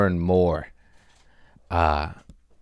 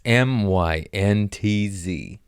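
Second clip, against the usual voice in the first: slow spelling; much slower than usual. Shown as a demonstration. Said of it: "spelling it out".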